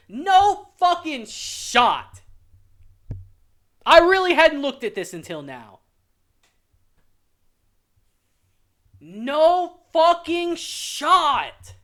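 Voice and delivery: very slow, dragged out words; slow seething disbelief. Real, not acted. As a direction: spell it out word by word in real anger. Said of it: "I'm heated".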